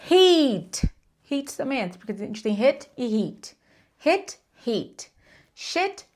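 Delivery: "hit" short and "heat" long, each with a falling tune; minimal pair drill. Shown as a demonstration. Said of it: "esse cair de som aqui, ó, esse tom".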